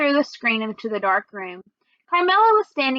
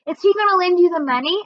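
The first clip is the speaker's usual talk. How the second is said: excited; 7 semitones higher, 4 dB louder, faster than usual. A narrator's reading. Excited question about the loan.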